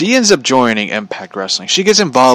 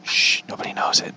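mock-secret whisper; hushed secret; 10 dB quieter than usual. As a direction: drop to a soft whisper, as if shushing. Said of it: "and it's like, shh".

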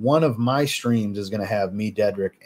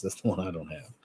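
normal talking voice

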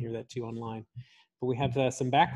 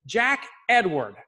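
stern parent call; 5 semitones higher, 5 dB louder, slower than usual. Retold or imitated. Parent calling son by middle name.

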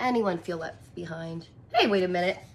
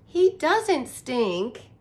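put-on slow, louder little girl; girl insisting; 4 semitones higher, 6 dB louder, much slower than usual. Reader voicing the girl defending the skunk. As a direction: stretch it out, defending her pet. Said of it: "the girl adds".

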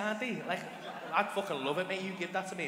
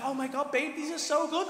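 high, thrilled gushing; mock gushing gratitude in a high voice; imitated delight; 7 semitones higher than usual. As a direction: mimic an overjoyed partner, pitched up. Voicing partner thrilled by iPad.

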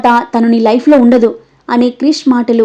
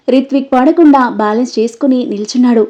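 usual speaking voice

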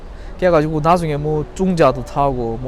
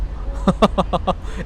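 breathy chuckle; 4 semitones higher than usual. Real, not acted. Laughing at absurd situation.

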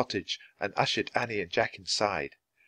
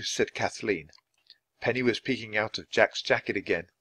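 usual speaking voice

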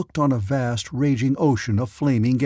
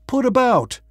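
urgent cry; 11 semitones higher, 4 dB louder than usual. Acted. Desperate order in crisis.

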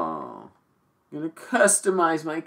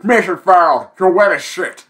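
game announcer voice; 5 semitones higher, 5 dB louder than usual. Retold or imitated. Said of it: "like the fucking Call of Duty".

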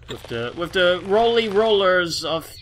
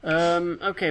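usual speaking voice

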